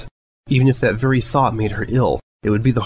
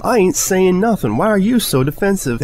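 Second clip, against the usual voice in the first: mock heated country shout; angry retort; 10 semitones higher than usual. Friends quarrel, yelling.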